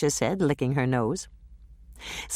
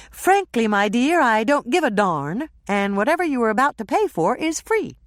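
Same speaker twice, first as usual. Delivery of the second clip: higher, louder quoted line; movie quote; 8 semitones higher, 4 dB louder than usual. Acted. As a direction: ham up the famous line. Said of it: "all you have to do is say".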